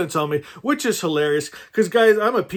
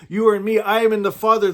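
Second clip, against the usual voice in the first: usual speaking voice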